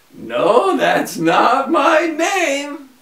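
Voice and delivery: high, slow, drawn-out teasing; a little man gloats, pitched high; gloating imp. A narrator's reading. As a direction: savour each word of the denial. Little man denies again.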